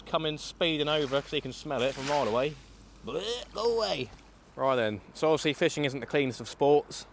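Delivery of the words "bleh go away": drawn-out, higher mock shoo at a bird; playful bleh at a gull. Real, not acted. Talking to a seagull.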